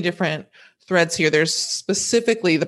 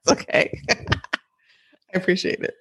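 laughing; 2 semitones higher than usual. Real, not acted. Still laughing with co-host.

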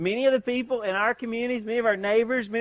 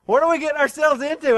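alarmed exclamation; 7 semitones higher, 6 dB louder than usual. Retold or imitated. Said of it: "I'm like".

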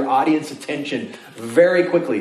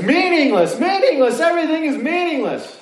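exaggerated proclamation; 14 semitones higher than usual. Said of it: "techniques ... is to exaggerate stuff".

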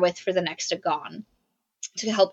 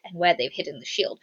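cold villain; 5 semitones lower, 7 dB quieter than usual. Retold or imitated. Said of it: "she's like".

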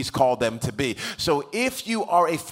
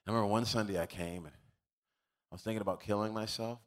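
low, hushed confiding; soft, low-pitched testimony; sombre retold confession; 5 semitones lower, 8 dB quieter, faster than usual. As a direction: voice a man quietly admitting dark thoughts. Gym guy confessing dark suicidal thoughts.

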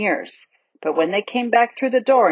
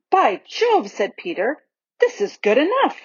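delighted; 6 semitones higher than usual. A narrator's reading. Peter delighted by the beach.

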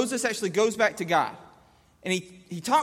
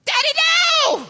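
imitated child yelling; 8 dB louder than usual. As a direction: shriek it like a protesting toddler. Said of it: "you're still yelling".

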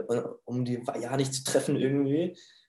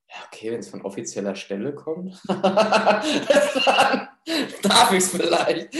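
laughing through the words, pitched higher and louder; 7 semitones higher, 5 dB louder, slower than usual. Retold or imitated. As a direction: giggle out the relief as you say it. Retelling own reaction, laughing. (lachend, höher und lauter)

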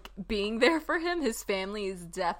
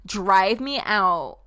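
slow, louder mock fury; mock angry vow; 8 dB louder, slower than usual. Edward refusing to be driven from his home, louder mock anger. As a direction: lean into a hammed-up territorial threat. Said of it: "he got really mad".

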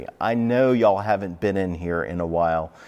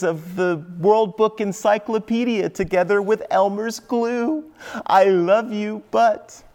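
much higher, sing-song schoolteacher scold; sing-songy librarian; 9 semitones higher than usual. Retold or imitated. Preacher mimics his first-grade librarian's scolding, sing-songy. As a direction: lilt it up high like a cheery librarian. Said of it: "in that same sing-songy tone of voice".

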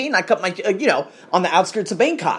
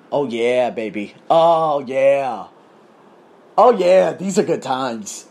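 sing-song gloating oh yeah; slow, savoring good times; mock gloating; 4 semitones lower, much slower than usual. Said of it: "mock celebration".

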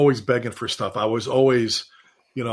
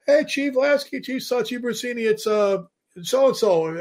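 higher, eager caller greeting the chiefs; a caller phoning the show, pitched-up eager voice; mock caller voice; 8 semitones higher than usual. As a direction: play the excited caller ringing in, pitched up. Caller voiced in retold story.

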